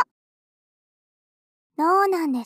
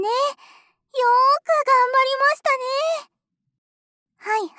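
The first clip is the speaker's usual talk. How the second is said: doting praise; 6 semitones higher, slower than usual. Acted. Praising his desperate cry.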